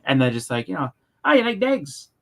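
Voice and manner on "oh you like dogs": high-pitched imitated Pikey accent; Pikey accent impression. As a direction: mimic a movie Pikey's accent.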